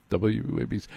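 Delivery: hushed echo of the station name. Shown as a demonstration. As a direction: drop the volume for mock importance. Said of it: "I lower my voice".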